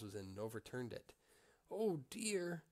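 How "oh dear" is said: sighing. A narrator's reading. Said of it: "Little Claus sighed".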